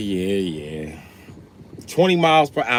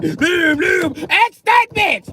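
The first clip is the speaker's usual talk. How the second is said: very high loud slow booming; high yelled crash noises; shouted boom; 8 dB louder, much slower than usual. Retold or imitated. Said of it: "hit the clutch on his ass like".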